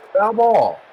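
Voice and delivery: loud, high excited call. Real, not acted. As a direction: shout the foul call. Calling a foul in play-by-play.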